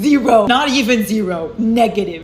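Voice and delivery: ZERO punched loud and firm; emphatic loud. Real, not acted. Defending favorite couple to viewers, loud and emphatic. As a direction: shut down any slander. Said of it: "I will take zero slander".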